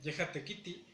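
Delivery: "Yeja tequiti" said again with the final I cut short; Nahuatl pronunciation demo. Shown as a demonstration. Teacher repeating "Yeja tequiti" for students, short final I. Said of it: "Va de nuevo".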